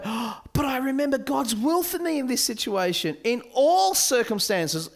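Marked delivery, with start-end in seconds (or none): 0.0-3.2 put-on pious teen.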